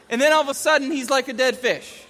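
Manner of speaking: ordinary delivery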